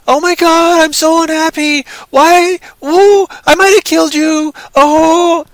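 high, loud melodramatic mock wailing; Daryl Hannah, overacting. Reviewer imitates an actress overacting, melodramatic high wail. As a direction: overact wildly in a high, distressed voice. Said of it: "Daryl Hannah, she's".

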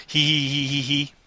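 soft spoken hee-hee giggle; sly giggle. Real, not acted. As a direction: snicker at your own joke. Giggling at own Texas joke.

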